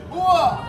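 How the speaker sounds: strong, sharp Army grunt; barked hooah. Shown as a demonstration. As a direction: bark it out in agreement. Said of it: "A strong hooah means I really agree".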